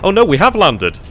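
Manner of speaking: loud, high burst of surprise; surprised shout. Real, not acted. Gamer learning the paratroopers landed, shouting in surprise. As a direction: shout it as the good news lands.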